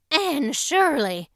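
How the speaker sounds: loud, slow shocked cry of a name; exclaimed. Acted. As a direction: call her out, alarmed and loud. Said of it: "she exclaimed".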